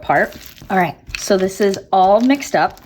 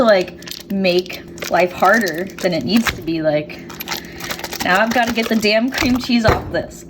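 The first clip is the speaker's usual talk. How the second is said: ordinary talk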